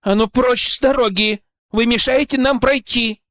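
angry command. A narrator's reading. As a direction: order them out of the way. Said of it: "рыкнула Ольга".

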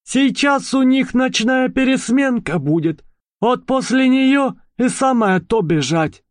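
high, perky little mouse. Acted. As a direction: play the cheeky mouse convict. Mouse character's voice. (писклявый голос мыши)

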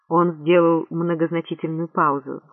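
ordinary delivery, nothing marked

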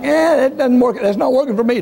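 put-on high-pitched whiny kid complaint; mock child protest. Preacher mimics himself as a boy, high-pitched and whiny. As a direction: whine like a boy dodging a spanking.

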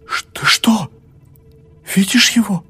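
high, loud terrified questions; horrified. A narrator's reading. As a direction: gasp in horror. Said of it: "ужаснулась больная". (в ужасе, высоко и громко)

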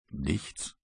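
low, quiet one-word answer; terse refusal. Acted. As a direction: give nothing away, low and soft. Matrose verweigert erneut die Antwort. (leise, tiefe, knappe Antwort)

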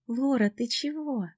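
high, slow, concerned; worried friend. A narrator's reading. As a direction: ask gently, with worry.

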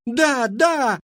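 very high, loud yes-yes; crowd shouting. A narrator's reading. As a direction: shout eagerly from the front rows. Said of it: "крики". (громкий высокий выкрик «да, да»)

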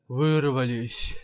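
slow, drawn-out sigh of a word; long relieved exclamation, somewhat exhaled; exhaled in relief. A narrator's reading. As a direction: breathe it out loudly, relieved to escape. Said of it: "выдохнул Каблуков".

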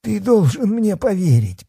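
high-pitched desperate plea; squeaked. A narrator's reading. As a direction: squeak it out, begging to be believed. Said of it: "пискнул Бахметьев".

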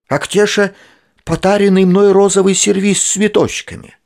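put-on high, loud sugary old lady; pleasant voice. A narrator's reading. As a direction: sweet-talk the doctor as a neighbour lady. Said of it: "приятным голосом".

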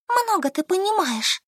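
высоко, с пренебрежением; осадить подругу; пренебрежительно. A narrator's reading. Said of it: "влезла Солька". (высокое пренебрежение)

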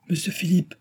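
high-pitched exclamation at normal volume; servant's high cry of recognition; excited cry. A narrator's reading. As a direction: cry out the name of someone just spotted. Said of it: "S'écria Nicole".

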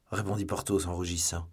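ordinary delivery, nothing marked

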